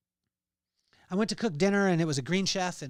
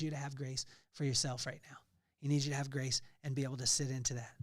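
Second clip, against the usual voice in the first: whisper; 3 semitones lower, 5 dB quieter, faster than usual. Real, not acted. Intimate encouragement to listeners.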